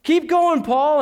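calling out from the pews; excited congregation. Retold or imitated. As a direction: cheer the preacher on like the congregation. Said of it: "all of the synagogue would have been saying".

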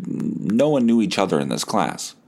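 normal talking voice

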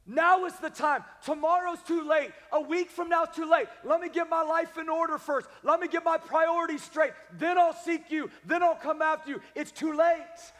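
loud and high, pressing hard; urgent shouted preaching. Acted. Pastor warning the church not to delay, urgent shouted preaching. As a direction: warn them it's too late.

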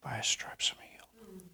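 soft unvoiced whisper; hushed whisper. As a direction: whisper the promise. Quiet faith declaration, whispered.

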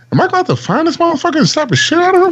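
high-pitched, fast mock outrage; retold indignation. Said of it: "I was like".

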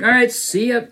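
high-pitched, jokey farewell; retold escape line, pitched well up; mock-cheery getaway. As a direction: toss off a high, hasty goodbye. Retold hurried getaway by car.

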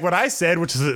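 usual speaking voice

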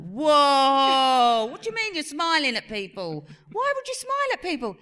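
loud whoa into high questioning; alarmed parent. Retold or imitated. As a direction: react as a shocked mum, retold. Alarmed parents questioning smiling son.

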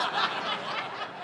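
short breathy laugh; real laugh. Real, not acted. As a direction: laugh at the irony. Laughs at his own story.

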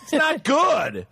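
mock stern parental verdict; disapproving dad. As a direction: spell out your disapproval, drawing the words out. Dad scolding over a report card.

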